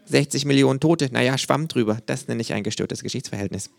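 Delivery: high, dry, know-it-all kangaroo. A narrator's reading. Kangaroo lecturing a flag-draped fan, high and dry. Känguru voice, held.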